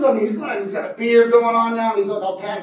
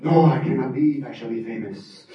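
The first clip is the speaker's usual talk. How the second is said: low stubborn vow; 8 semitones lower than usual. Acted. Fernando refusing, grand.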